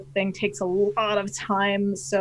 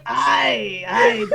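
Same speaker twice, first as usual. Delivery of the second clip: recalibrated pirate voice; 3 semitones higher, 6 dB louder than usual. Acted. Getting back into character, Canadian pirate voice. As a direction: call out like a hammy pirate. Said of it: "recalibrate your voice".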